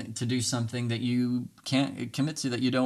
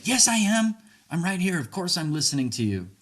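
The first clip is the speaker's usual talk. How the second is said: defensive protest; 7 semitones higher than usual. Retold or imitated. Answering a partner who feels unheard, defensive. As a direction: bristle and insist. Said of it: "But don't go there".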